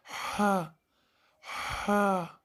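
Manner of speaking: हा breathed hard from the middle of the throat; Arabic ha. Shown as a demonstration. Quran teacher demonstrating the throat letter, breathy. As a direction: push air out for हा like a sigh from the throat. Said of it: "जिस तरह मुंह से हवा बाहर निकल रही हो".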